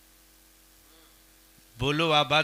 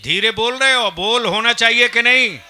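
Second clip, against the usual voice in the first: loud, raised call for an answer; demanding shout; 6 semitones higher, 9 dB louder than usual. Real, not acted. Preacher pressing the crowd for a louder yes, shouting.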